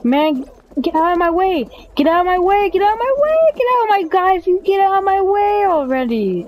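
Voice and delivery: high-pitched exasperated shouting; worked-up cry at people in the way; frantic yelling. Real, not acted. Yelling at players blocking him.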